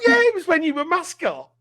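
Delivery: lightly voiced beaming mate; pal's cheerful insistence. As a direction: play the pal, sure of himself. Pal voiced in retold story.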